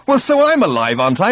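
loud, high comeback; angry retort. A narrator's reading. Arthur retaliating against Ford, angry. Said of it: "retaliated Arthur, haggard with adventure and anger".

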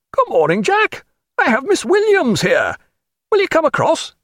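high-pitched cheery hail; called out. A narrator's reading. Friend on quarterdeck inviting captain across, high calling. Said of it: "called out from the quarterdeck".

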